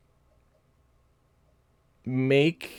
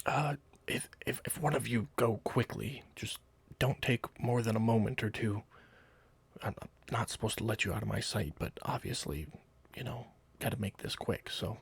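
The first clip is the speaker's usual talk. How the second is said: hushed, softer guard voice; furtive guard; 7 dB quieter than usual. Acted. Guard letting one of them slip away quickly, hushed. Game master plays the guard.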